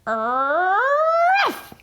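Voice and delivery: high loud yappy bark; big dog bark. A narrator's reading. Skipito barking at the dinosaur, high yap. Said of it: "let out a big Jurassical bark".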